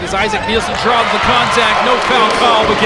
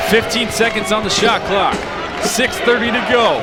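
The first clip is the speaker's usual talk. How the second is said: excited live game call; play-by-play; 3 semitones higher than usual. Real, not acted. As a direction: call the action as it happens.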